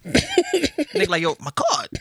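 high-pitched laughing retelling; cracking up mid-quote. Real, not acted. Laughing through retold quote.

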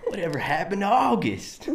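put-on high, botched British-sounding accent. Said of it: "Just very stereotypical British accent".

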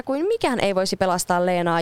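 usual speaking voice

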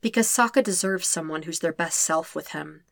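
plain reading voice

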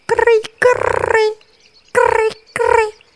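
very high cricket chirps; tiny squeaky cri-cri; rabbit chirping. Acted. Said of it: "un conejo ... dijo".

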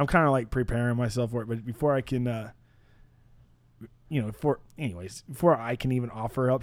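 ordinary delivery

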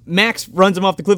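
ordinary talk, nothing marked

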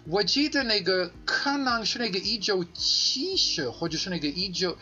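ordinary delivery

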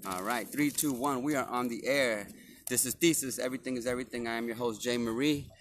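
normal talking voice

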